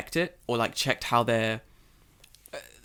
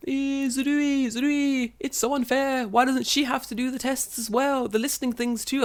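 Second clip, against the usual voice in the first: whining class; 12 semitones higher than usual. Retold or imitated. Class complaining to the teacher, high mocking whine. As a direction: imitate kids protesting unfairness. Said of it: "the class is like".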